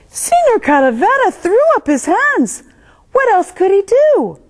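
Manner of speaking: exasperated. A narrator's reading. Narrator voicing exasperation.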